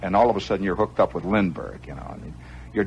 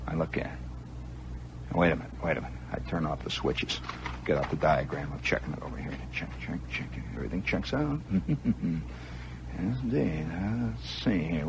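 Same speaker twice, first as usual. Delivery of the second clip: muttering to oneself; 6 dB quieter than usual. Retold or imitated. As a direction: think aloud quietly, half to yourself. Muttering to himself while troubleshooting.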